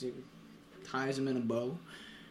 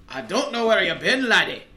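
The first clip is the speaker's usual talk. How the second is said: raised, louder Scottish punchline voice; Scottish accent; 5 dB louder than usual. Acted. Puzzled Scotsman addressing Mister Happy, raised Scottish accent. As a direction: put on a hearty Scottish accent.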